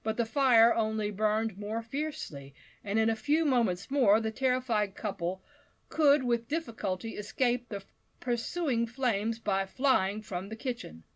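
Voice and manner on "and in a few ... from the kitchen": usual speaking voice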